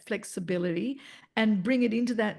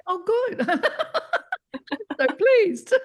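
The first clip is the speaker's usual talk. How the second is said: laughing; 9 semitones higher, 5 dB louder, slower than usual. Real, not acted. Teacher delighted by student feedback.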